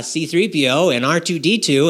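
normal talking voice

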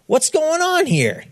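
puzzled question. Acted. Older son asks servant.

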